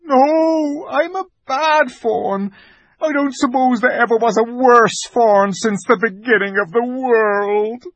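a creature sunk in shame; despairing fawn. Acted. The fawn calls himself the worst fawn, despairing. Fawn laments, voiced figure.

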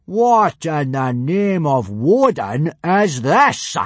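slow, high, outraged bellowing; thunderous drill-sergeant outrage; furious bellow. A narrator's reading. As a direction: roar each word out slowly. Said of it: "pointing furiously at the Roman helmet".

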